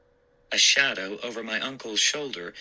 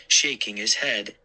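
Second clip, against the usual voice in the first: usual speaking voice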